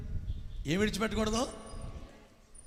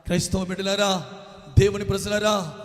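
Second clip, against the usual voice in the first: usual speaking voice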